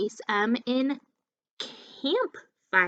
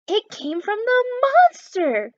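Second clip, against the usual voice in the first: high, louder, slower fearful cry; frightened cry; 11 semitones higher, 8 dB louder than usual. Acted. Murphy terrified by the moan, crying out high and loud. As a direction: wail it in real fright. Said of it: "cried Murphy".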